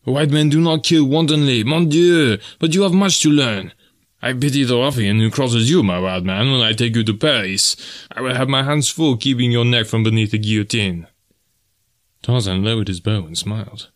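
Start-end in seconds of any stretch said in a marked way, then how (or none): 0.0-11.0 Frenchman scolding his wild friend. Acted. Voices Deonot scolding Tarzan.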